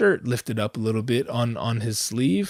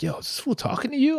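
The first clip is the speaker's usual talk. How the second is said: a higher, quick voice for the homie; high mock friend voice; 12 semitones higher, faster than usual. Friend beside him asks, in a high quick mock voice. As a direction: pitch up and rattle it off as the buddy. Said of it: "And he's like".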